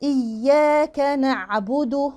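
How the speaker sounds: very slow "إياك نعبد" with the ayn held; articulated ayn. Shown as a demonstration. Teacher models the phrase, slow measured recitation. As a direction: sustain the throat sound in "نعبد". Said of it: "العين أحققها".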